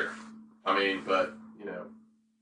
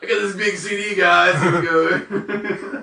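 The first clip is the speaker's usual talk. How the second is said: high, loud laughing hype; excited mock hype; 11 semitones higher, 8 dB louder, slower than usual. Joking about big CD.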